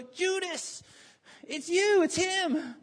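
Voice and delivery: high, loud accusing outcry; mock accusation. Disciples pointing out Judas to each other, high mock accusation. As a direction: call him out in mock alarm.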